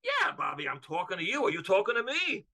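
high-pitched mock heavenly reply, rising at the end; God answering back. The Father in heaven answering Bobby, comic mimicking voice. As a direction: play God throwing the question back. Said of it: "And the Father's up in heaven going".

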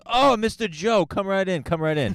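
butter him up like a doorman; a high fawning welcome; eager doorman. Retold or imitated.

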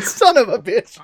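high, loud playful outburst; loud mock outrage. Real, not acted. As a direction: shout it in mock betrayal.

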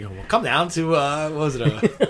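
lightly voiced old-timey announcer; a hint of an old radio pitchman. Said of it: "The old-timey voice".

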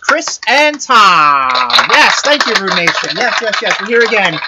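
upbeat red carpet host; loud, eager showbiz hosting; gushing red carpet chatter. Acted. Hosting red carpet pre-show.

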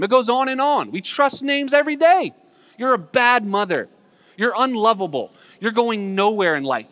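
high, loud sermon delivery; impassioned preaching. Real, not acted. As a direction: hammer each line home to the congregation. Preaches at raised force.

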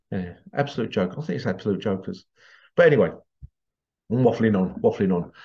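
usual speaking voice

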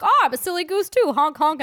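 lightly mocking a goofy guy; imitating a guy. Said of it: "He's like".

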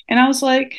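usual speaking voice

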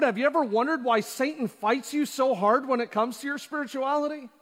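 ordinary talk

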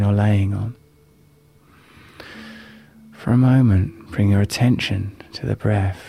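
calm, unhurried guiding voice. Acted. Leads a live guided meditation.

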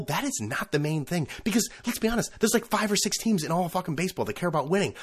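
ordinary delivery

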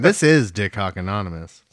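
ordinary talk, nothing marked

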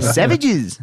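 high-pitched mock Aussie; Australian accent. Echoing the host in a high, exaggerated Australian accent. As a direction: throw it out high in cartoon Australian. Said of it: "it's hard to keep the accent".

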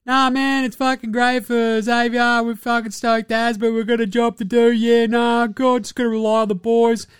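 high put-on athlete voice; high-pitched imitation of stoked players; teammates' interview voice. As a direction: parody footy-style interview clichés in one high run. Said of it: "and they're like".

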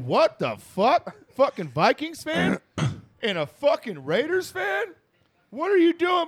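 loud, drawn-out taunt climbing high; mock outrage, stretching each jab; trash-talking. Rival fan heckling a car of Vikings and Raiders fans, trash-talking. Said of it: "he's just talking shit".

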